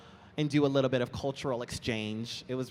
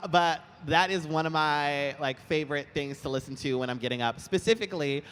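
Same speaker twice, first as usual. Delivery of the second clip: usual speaking voice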